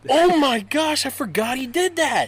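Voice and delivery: high, loud burst of surprise; real shock at remembering; surprised. Real, not acted. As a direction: yelp it as the memory hits. Suddenly remembering prank.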